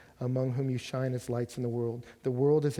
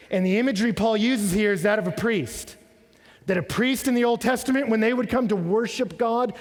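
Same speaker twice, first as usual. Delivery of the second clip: higher, louder preaching that drives every phrase; full-force preaching; 9 semitones higher, 8 dB louder than usual. Acted. Pastor preaching on Paul's sacrifice to the church, full force. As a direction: preach it to the back row.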